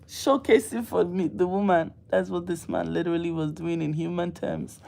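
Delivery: ordinary talk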